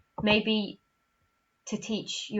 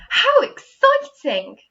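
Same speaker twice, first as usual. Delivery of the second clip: much higher, loud, slow gush; excited exclamation; 12 dB louder, slower than usual. Shown as a demonstration. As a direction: sound thrilled to bits. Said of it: "did you see Miss Andrews put expression on".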